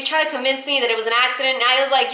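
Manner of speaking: ordinary delivery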